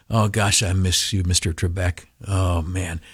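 soft, low lament; wistful aside. Real, not acted. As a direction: sigh it low, as if to yourself. Wistful aside about late quiz host.

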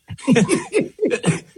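a high, bright laugh at his own joke; burst of laughter. Real, not acted. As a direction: let a real laugh break out, high.

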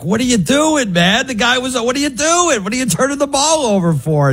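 indignant armchair-fan complaint, high but held back; retold yelling at TV. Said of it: "I yell at the T V".

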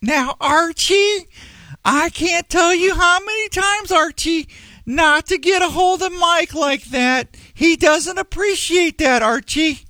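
shrill-free high Edith voice; Edith Bunker voice. Retold or imitated. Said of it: "That was good".

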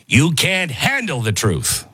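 slow, emphatic movie-quote delivery; a hint of courtroom-movie outburst; Jack Nicholson. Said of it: "I'm turning into Jack Nicholson".

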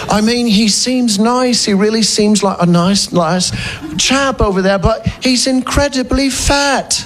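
sneering Brit, building up then ripping down; English accent. Retold or imitated. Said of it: "And the English are brilliant at it".

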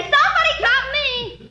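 high, slow frantic plea; panicked cry for help; frantic call. Acted. Girl panicking, late for school.

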